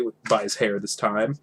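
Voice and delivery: usual speaking voice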